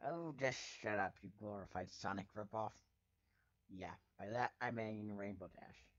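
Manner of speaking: usual speaking voice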